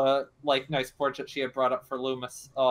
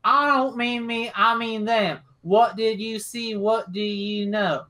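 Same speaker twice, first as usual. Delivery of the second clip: pitched-up, emphatic questioning; acted impatient interrogation, slow at the end; exasperated; 5 semitones higher, slower than usual. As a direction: demand answers, slowing down word by word. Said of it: "like, sighs and then, like, slaps him".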